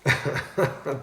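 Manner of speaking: high, quick burst of laughter; real laugh. Real, not acted. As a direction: laugh just before repeating the joke. Laughs before repeating joke.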